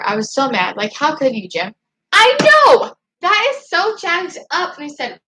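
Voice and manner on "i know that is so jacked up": loud, high burst of indignation; outraged agreement. Real, not acted. Vlogger agreeing with viewers about Jim, loud outrage. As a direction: erupt high, then stretch out the complaint.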